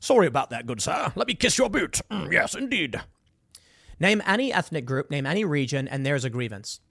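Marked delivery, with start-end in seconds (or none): 0.0-3.0 British gentleman impression with swooping pitch; caricatured, deferential British gentleman; mock British accent. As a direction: put on a hammed-up British accent. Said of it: "a British guy kissing the boots".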